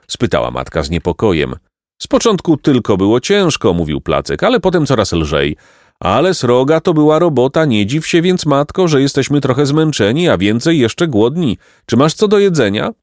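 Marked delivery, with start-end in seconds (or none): 2.0-12.9 higher boyish voice, playing tired; sly hungry boy. A narrator's reading. A lightly voiced boy, hamming up his tiredness. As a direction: Placek angling for food from mother. Placek claims work, asks food.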